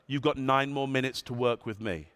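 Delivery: ordinary talk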